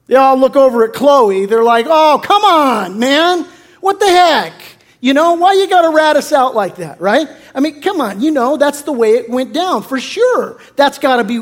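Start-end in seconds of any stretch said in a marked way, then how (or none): 1.9-7.3 high, loud, exasperated whining at a tattletale; indignant protest. Retold or imitated. Church members to Chloe, indignant loud complaint. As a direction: moan it like you've just been ratted out.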